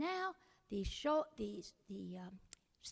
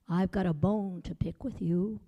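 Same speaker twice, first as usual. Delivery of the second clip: skeleton voice; 7 semitones lower, 10 dB louder than usual. A narrator's reading. Skeleton confronts Jack, lower and louder. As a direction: lower and louder bony growl.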